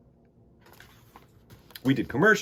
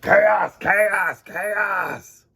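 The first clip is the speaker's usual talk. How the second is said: higher, louder yelps; chaotic shouting; 8 semitones higher, 7 dB louder, much slower than usual. Acted. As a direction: yell it wilder each time.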